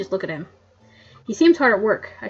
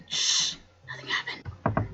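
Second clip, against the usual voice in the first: mock secretive whisper; 7 dB quieter, slower than usual. Joking about stealing.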